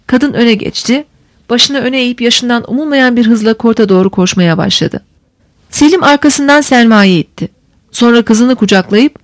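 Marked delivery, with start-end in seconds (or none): none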